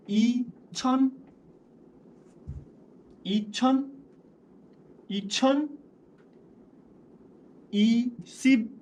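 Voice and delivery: ई छन, ई सिब read out high and slow, each syllable apart; syllable-by-syllable Korean. Shown as a demonstration. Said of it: "देखो इसको सीधा गिनती में पढ़ो".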